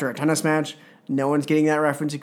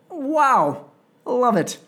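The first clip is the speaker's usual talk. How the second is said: delighted wow; 8 semitones higher, slower than usual. Real, not acted. Touched by Goldberg's tears.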